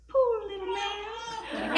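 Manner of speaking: put-on high, slow, tiny child's voice; cute little girl voice. Mimicking the little girl in the elevator, high and slow. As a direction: coo it like a sweet five-year-old. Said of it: "the cutest, high-pitched".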